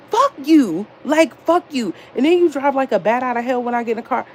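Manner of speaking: high-pitched, exasperated cussing out; retold angry outburst. Fed-up friend to late homeboy, acting out an angry outburst. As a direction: cuss him out in an acted rant, speeding up at the end.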